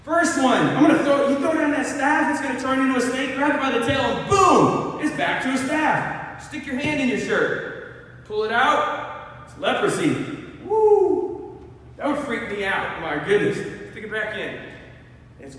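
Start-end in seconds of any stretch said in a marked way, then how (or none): 0.0-10.1 booming God listing signs; God laying it out. Retold or imitated. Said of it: "He's like". 10.6-11.6 high excited whoop; startled whoop of amazement. Real, not acted. Reacting to the leprosy miracle.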